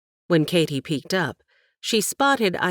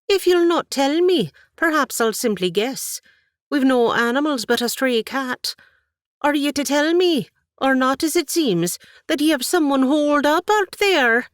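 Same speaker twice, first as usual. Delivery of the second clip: Irish brogue growing louder and higher; rising Irish accent; 10 semitones higher, 5 dB louder than usual. Acted. Aunt confronts Katie about hiding someone, Irish accent rising loud. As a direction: let the Irish thicken as the anger climbs. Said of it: "Her Irish accent increased along with her volume".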